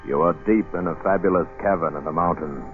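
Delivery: ordinary delivery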